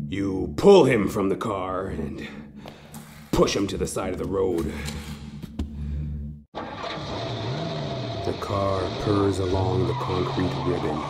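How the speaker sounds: ordinary delivery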